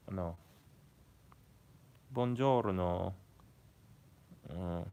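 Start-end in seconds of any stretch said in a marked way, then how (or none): 2.1-3.1 slow, stretched Italian greeting; drawn-out mock Italian; Italian accent. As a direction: put on an Italian accent and draw it out. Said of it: "how to do the Italian accent".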